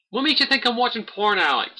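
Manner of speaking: shocked, defensive protest to the viewer; high-pitched indignant squawk; indignant disbelief. Real, not acted. Denies a viewer's porn accusation.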